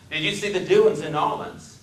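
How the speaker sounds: Deep South man making chat; deep Southern accent. Acted. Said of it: "His speech was the deepest South".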